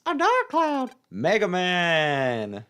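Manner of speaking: high, drawn-out dramatic cry; alarmed cry. Acted. Friend watching a dark cloud engulf Mega Man, high alarmed cry. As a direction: cry out in hammed-up horror.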